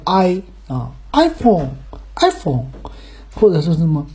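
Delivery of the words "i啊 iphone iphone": iPhone with a stretched, stressed first syllable. Shown as a demonstration. Showing where stress goes, front-stressed iPhone. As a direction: lean slowly on the I in iPhone, let the rest fall. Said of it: "那个重音在前面".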